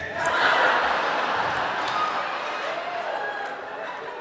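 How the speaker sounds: silent breathy cackle; high wheezing laugh. Real, not acted. Comic laughing at his own stick joke.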